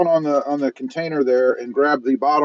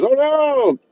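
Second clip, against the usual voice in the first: high, drawn-out "oh no"; mock alarm; 12 semitones higher than usual. Streamer reading a viewer's comment aloud, mock alarm. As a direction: squeal it in playful pretend alarm.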